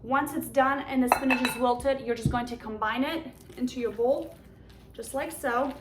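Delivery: normal talking voice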